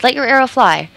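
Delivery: high, loud command; crying out. Acted. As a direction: shout the order across the lake. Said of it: "she cried".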